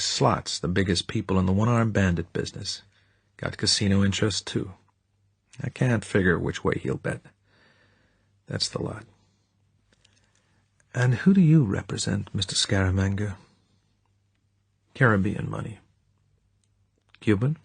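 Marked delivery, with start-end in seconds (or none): none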